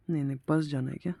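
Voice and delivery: ordinary delivery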